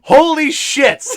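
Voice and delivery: loud, high, drawn-out amazement; astonished exclamation. Real, not acted. Reacting to co-host's Zelda creation story, astonished. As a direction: exclaim it slowly, blown away.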